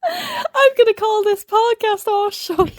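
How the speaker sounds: words squeezed out through high giggles; giggling high and slow while talking; laughing. Real, not acted. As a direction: laugh your way through the podcast name. Laughing at her own podcast name.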